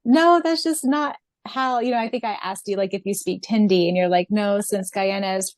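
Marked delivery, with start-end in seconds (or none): none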